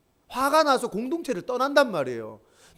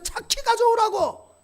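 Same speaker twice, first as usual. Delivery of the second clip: pitched way up and slowed, every syllable shoved out; mock furious yell; 14 semitones higher, 3 dB louder, slower than usual. Husband screaming at his wife for the key, slow high-pitched yell. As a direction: bellow the order in a rage.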